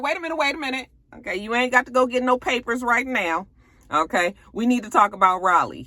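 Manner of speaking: high, hurried backpedalling; imitating a flustered wife; mock panicked wife. Wife stopping him in a panic.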